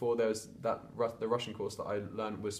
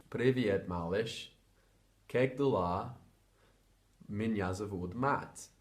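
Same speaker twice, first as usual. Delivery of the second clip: slow careful Russian; slower than usual. Shown as a demonstration.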